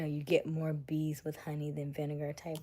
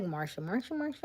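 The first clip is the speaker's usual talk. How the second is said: mock whiny complaint; 2 semitones higher than usual. Complaining it's always someone else, imitated sitcom whine. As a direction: whine the name in mock jealousy.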